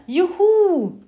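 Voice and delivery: put-on high, loud child's cheer. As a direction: whoop with joy.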